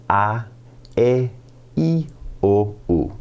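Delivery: "A, E, I, O, U" as pure Spanish vowels, ah eh ee oh oo. Shown as a demonstration. Teacher drills vowel sounds, Spanish vowels. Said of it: "pronouncing those vowels".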